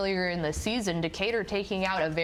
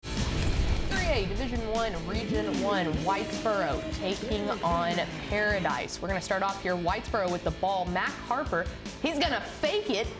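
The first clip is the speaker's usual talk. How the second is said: highlights play-by-play; 2 semitones higher than usual. Real, not acted. Announcer narrating Whitesboro's highlights against Paradise, rising play-by-play. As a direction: build from scene setting to a soaring, stretched call.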